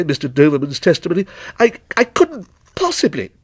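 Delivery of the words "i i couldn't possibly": high, stammering refusal; embarrassed protest, pitched high; flustered. Acted. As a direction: back out awkwardly, breaking off.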